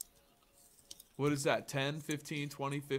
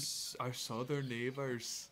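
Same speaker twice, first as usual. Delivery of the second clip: put-on slow, softer echo of a Canadian accent; mimicked Canadian accent, drawn out; imitated Canadian accent; 8 dB quieter, slower than usual. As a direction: copy the Canadian friend's accent. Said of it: "Such a strong accent".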